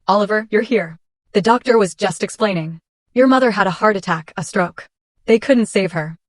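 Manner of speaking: usual speaking voice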